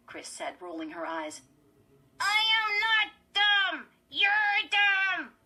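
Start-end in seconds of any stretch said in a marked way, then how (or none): 2.2-5.4 very high, loud puppet retort; high, squeaky dummy. A narrator's reading. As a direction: squeak back indignantly through gritted teeth. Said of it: "say in a high, squeaky voice".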